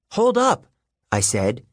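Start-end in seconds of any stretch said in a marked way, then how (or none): 0.1-0.7 loud, high call to stop her. A narrator's reading. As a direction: shout to make her wait. Stopping Clarice from leaving.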